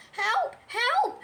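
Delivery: high squeaked alarm; high-pitched yelps for help; panicked Piglet cry. A narrator's reading. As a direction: cry out in a tiny terrified voice. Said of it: "cried Piglet".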